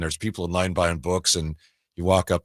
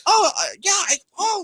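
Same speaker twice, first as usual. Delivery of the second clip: caught off guard. Retold or imitated. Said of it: "caught out".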